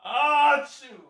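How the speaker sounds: high loud sneeze. Real, not acted. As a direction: sneeze loudly. Sneezing again.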